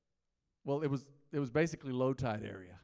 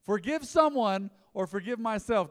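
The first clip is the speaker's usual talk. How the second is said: higher, louder, insistent; louder emphatic second take; louder insistent repeat; 6 semitones higher, 9 dB louder than usual. Shown as a demonstration. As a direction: repeat it so everyone hears. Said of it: "Hear me again".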